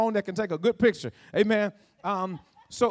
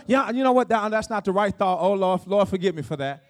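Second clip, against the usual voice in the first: lower, sinking voice of mock contrition; acted self-correction; 4 semitones lower than usual. Preacher models repenting of a bad thought, low and contrite. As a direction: drop low into a muttered little confession.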